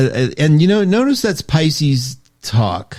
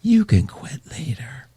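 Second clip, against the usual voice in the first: an unvoiced, tempting whisper; devil's whisper; 5 dB quieter than usual. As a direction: whisper like the devil of nicotine luring you. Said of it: "it has a voice and it goes".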